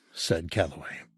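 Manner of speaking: ordinary delivery, nothing marked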